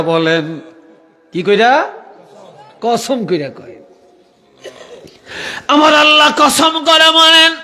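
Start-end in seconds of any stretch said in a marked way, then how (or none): 5.7-7.5 very high sustained proclamation; impassioned preaching cry. Real, not acted. Preacher proclaiming God's oath to the congregation, high cry.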